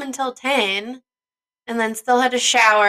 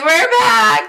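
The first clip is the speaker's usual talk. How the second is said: excited shout; 11 semitones higher, 5 dB louder than usual. Retold or imitated. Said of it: "They're like".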